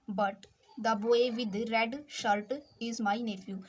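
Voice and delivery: the English line read very slowly, each word apart; slow dictation. Shown as a demonstration. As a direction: dictate but the boy with red shirt is my nephew slowly. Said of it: "teacher rereads sentence slowly". (पूरा अंग्रेज़ी वाक्य बहुत धीरे, हर शब्द अलग बोलना)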